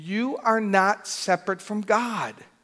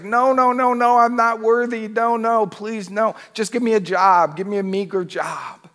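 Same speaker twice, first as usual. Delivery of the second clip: loud, high pleading no no; hammed-up begging son, high and loud; 6 semitones higher, 6 dB louder than usual. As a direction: beg the father, unworthy and desperate. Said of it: "his son's like".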